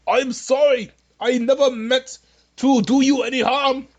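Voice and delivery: put-on a clumsy send-up of Bernie Sanders' accent, pleading and apologetic; hammed-up Bernie Sanders accent, sorry and submissive; bad Bernie Sanders accent. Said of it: "This is a bad accent".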